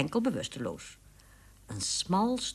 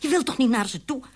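anxious plea; 8 semitones higher, 4 dB louder, faster than usual. Acted. Widow pleads, fast.

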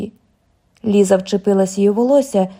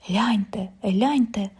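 hushed, breathy whisper of a neighbour; 6 dB quieter, slower than usual. A narrator's reading. Neighbour whispering to the crowd, hushed whisper. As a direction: whisper it, trying not to be heard. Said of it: "прошепотіла одна із добрих сусідок".

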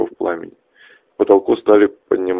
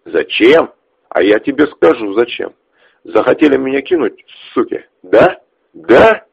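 angry; 5 semitones higher, 6 dB louder than usual. Acted. Ches snarls at his friend.

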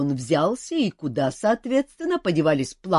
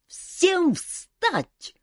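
barked command. A narrator's reading. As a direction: bark it at full voice. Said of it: "в полный голос рявкнул он".

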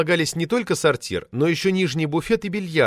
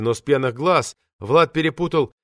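usual speaking voice

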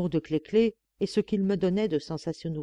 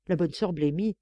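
usual speaking voice

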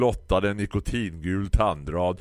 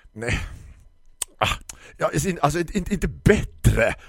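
puffing florist; 9 semitones higher, 5 dB louder than usual. Acted. Flustered florist huffs as she struggles to explain. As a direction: huff and fumble for words. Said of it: "stånkade Tisten".

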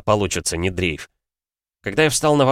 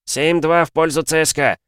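football commentator; 8 semitones higher than usual. Acted. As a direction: announce the goal like a TV commentator. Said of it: "С интонациями футбольного комментатора".

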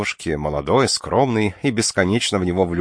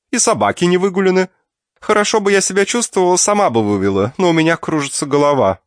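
complaining aunt; 11 semitones higher, 5 dB louder than usual. Acted. Aunt complains, dogs not walked.

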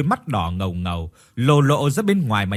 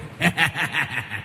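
leises unheimliches Lachen; eerie laugh; 5 dB quieter than usual. A narrator's reading. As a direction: ein leises Gespensterlachen. Ghostly visitor laughs.

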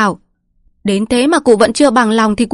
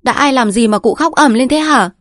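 higher-pitched irritated challenge; snapping; 6 semitones higher, 4 dB louder than usual. A narrator's reading. Daughter-in-law rushing in at her wailing father-in-law, snapping. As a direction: snap back in a raised pitch. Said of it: "Mụ Bông từ ngoài sân chạy vào gắt lên".

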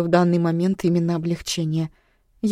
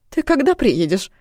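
mother's voice; 6 semitones higher, 4 dB louder than usual. Acted. Mother abruptly changes subject.

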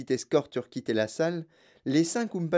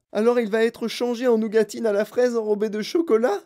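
high, loud wail of dismay; lamenting; 9 semitones higher, 5 dB louder than usual. A narrator's reading. Mother hears of nougatine fate, lamenting. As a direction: wail the question in horror. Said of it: "se lamenta Madame Gloop".

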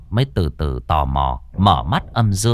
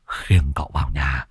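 whisper; 7 semitones lower than usual. A narrator's reading. Shushing the servants.

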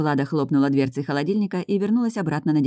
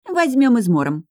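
higher, louder, firm warning; 8 semitones higher, 5 dB louder, slower than usual. A narrator's reading. Дарина tells Влада resistance is useless, warning. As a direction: warn her with raised pitch and force. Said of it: "предупредила Дарина".

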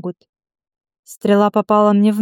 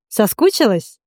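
lightly voiced teasing question; understated playful greeting. A narrator's reading. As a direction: tease her, a touch of mischief. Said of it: "задорно спросила Катра".

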